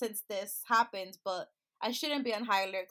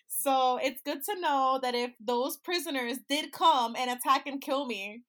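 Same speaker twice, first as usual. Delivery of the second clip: normal talking voice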